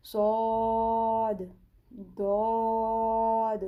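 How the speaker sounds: صاد and داد said full-mouthed and drawn long; full, lengthened letters. Shown as a demonstration. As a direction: recite صاد, داد heavy and stretched. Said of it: "پر بھی پڑھیں گے اور لمبا بھی کریں".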